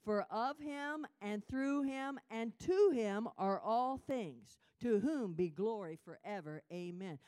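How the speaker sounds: loud, slow, ringing proclamation; preacher declares Romans eleven, loud and slow; preaching scripture. Real, not acted. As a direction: preach it with full force. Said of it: "Say that verse thirty-six again with me".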